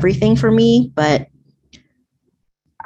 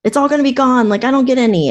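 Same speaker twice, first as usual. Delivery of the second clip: higher, mildly panicked child imitation; mock panic; 7 semitones higher, 3 dB louder, faster than usual. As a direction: lightly imitate a kid fretting it will all vanish. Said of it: "panicking, like".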